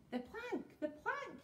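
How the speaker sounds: high-pitched excited pirate chant; mild yell. A narrator's reading. Two pirates calling for the troll's punishment, mild yell. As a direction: yell it twice, without full force. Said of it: "yelled Ben Buckle and Percy Patch".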